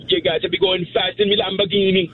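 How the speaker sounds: high-pitched put-on Jamaican accent. Shown as a demonstration. Caller saying Lamborghini on request, in a Jamaican accent. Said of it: "No, a Jamaican accent".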